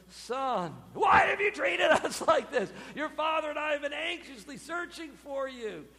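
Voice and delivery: loud, high, exasperated scolding; a mother who has searched for days. Retold or imitated. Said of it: "gives way to scolding".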